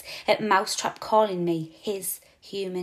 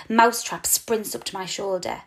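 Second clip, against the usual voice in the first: ordinary delivery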